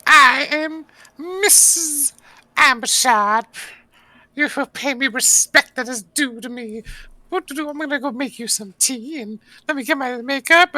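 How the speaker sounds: squeaky high ogre hostess; ogre lady voice. Acted. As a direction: play a haughty lady ogre demanding respect. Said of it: "Ogre was like".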